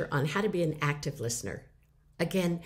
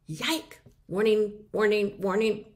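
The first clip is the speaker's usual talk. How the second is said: mock alarm; 4 semitones higher, 4 dB louder, slower than usual. Shown as a demonstration.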